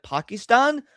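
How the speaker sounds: a parody of the enlightened "Pakistan?"; mock cultured Pakistan. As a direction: overdo the native "Pakistan?" to sound cultured. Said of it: "speak with a perfect American accent and be like".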